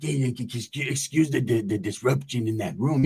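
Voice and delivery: low, soft mock-formal homie; high-power OG talk. OG apologising for the disruption, low pompous imitation. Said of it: "O Gs try to talk all high power".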